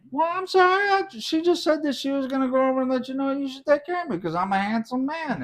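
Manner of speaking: high, pleading self-defence; mock-innocent excuse. Retold excuse to upset partner.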